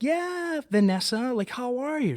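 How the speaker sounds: high, sing-song mock girly greeting; mock-sweet squeal of recognition; gushing greeting. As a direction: ham up a bubbly high hello to an old coworker. Mimicking her gushing greeting.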